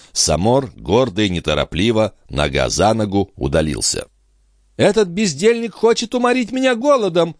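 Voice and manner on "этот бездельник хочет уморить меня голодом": high-pitched, indignant countess; indignant exclamation. A narrator's reading. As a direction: exclaim in high-voiced outrage. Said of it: "воскликнула графиня".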